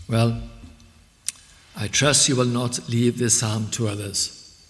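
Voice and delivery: usual speaking voice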